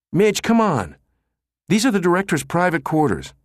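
high-pitched, urgent pleading; anxious protest. A narrator's reading. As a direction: plead nervously, voice pitched right up. Anxious aide pleads with nosy colleague.

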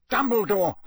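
collapsed man gasping for help; gasped. A narrator's reading. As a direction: gasp it out desperately. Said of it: "gasped Mister Crouch".

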